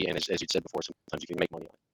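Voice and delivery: faint, breaking-up call audio; like a bad video-call line; barely audible. Real, not acted. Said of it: "is pretty terrible".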